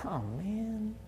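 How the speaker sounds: a soft, let-down oh man; mild disappointed sigh; quiet sigh. Retold or imitated. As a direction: sigh it out under the breath, head shaking. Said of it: "shook my head and said".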